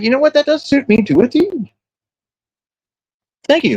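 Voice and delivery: very high falsetto mock delight; acted flattered reply. Retells flattered reply to Cash.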